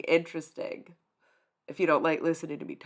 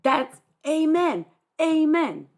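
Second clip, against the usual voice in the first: emphatic amen; 9 semitones higher, 7 dB louder, slower than usual. Real, not acted. Agreeing with a chatter.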